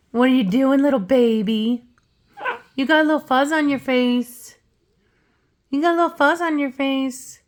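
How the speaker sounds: high cooing pet voice. Real, not acted. Cooing to her pet bird.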